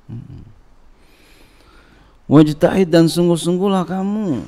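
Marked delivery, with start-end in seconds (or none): 2.3-4.4 emphatic kitab reading. Acted.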